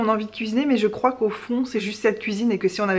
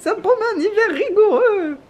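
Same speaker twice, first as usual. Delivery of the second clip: put-on high, laughing old man voice; hammed-up old grandpa, laughing; 10 semitones higher than usual. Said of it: "Faut le faire avec une voix de vieux".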